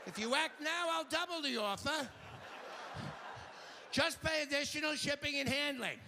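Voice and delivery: high, pushy "but wait" sell; infomercial pitchman. Pretend. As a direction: hype the offer like a late-night ad. Infomercial upsell parody.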